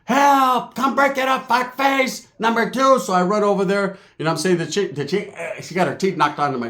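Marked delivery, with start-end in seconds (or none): 0.0-3.0 aunts screaming. Retold or imitated. Aunts scream for help during the fight, high mock yell. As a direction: call for help in a high screech. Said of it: "Everyone's screaming".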